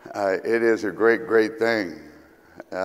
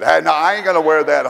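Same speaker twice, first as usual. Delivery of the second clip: playing stupid; 5 semitones higher, 5 dB louder, faster than usual. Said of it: "you just play stupid".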